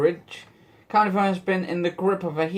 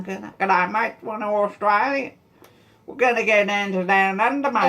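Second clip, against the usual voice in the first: jokey attempt at an Aussie accent; Australian stand-in for a New Zealand accent; 3 semitones higher than usual. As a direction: put on a mock Australian twang. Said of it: "pretty much similar to Australian".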